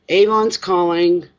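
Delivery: playful Avon jingle call; mock ad slogan. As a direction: ring it out like the old TV ad. Doorbell jingle imitation.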